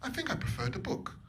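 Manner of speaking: lightly voiced posh snob, breathy and high; a hint of a sniffy posh film-goer. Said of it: "say to themselves".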